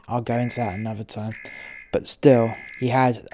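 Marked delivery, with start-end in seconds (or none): 0.1-1.3 softer and fast; insisting under your breath; hushed aside. Shown as a demonstration. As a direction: mutter it quickly to yourself.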